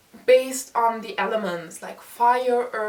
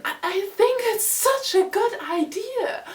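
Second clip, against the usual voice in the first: excited, much higher pitch; gushing enthusiasm; 10 semitones higher than usual. Real, not acted. Reviewer praising the villain's point of view, gushing excitedly. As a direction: gush with real, high-pitched delight. Gushing over the author's story idea.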